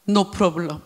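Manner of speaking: low, soft "No problem"; softly offered answer, voice dropped; quiet answer. Shown as a demonstration. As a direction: say it low and understated. First softly offered answer to question.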